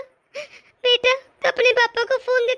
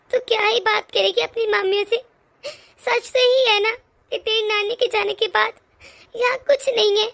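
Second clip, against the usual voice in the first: tearful, words breaking through sobs; crying. Acted. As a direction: speak through tears, hurt and wounded. Mother hurt, crying over insults.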